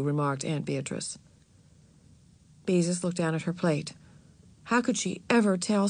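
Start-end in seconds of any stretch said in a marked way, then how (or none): none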